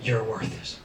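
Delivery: low, soft seductive ad slogan; sexy confidence. Acted. French L'Oreal advert slogan, oozing sexual confidence. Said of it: "Oozes sexual confidence".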